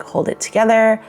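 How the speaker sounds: normal talking voice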